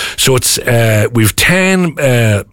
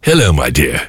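Cary Grant voice; 7 semitones lower than usual. Shown as a demonstration. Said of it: "you've gotta do the voice".

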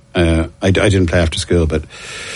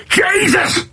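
Jesus yelled high and exasperated, mocking a rugby coach. Rugby coach yelling at a player who misses tackles, high exasperated cry. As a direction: throw up a high mock yell of Jesus. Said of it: "I heard that quite a lot".

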